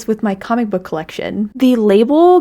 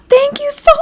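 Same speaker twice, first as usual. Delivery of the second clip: sharp gasp into a much higher thank you; surprised gasp; 4 dB louder than usual. Real, not acted. Recognizing a viewer's name on the note, gasping surprise. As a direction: catch your breath in sudden recognition.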